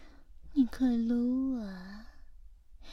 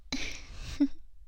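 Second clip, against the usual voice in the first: teasing giggle; 6 semitones higher, 3 dB quieter than usual. Acted. Teasing roleplay laugh.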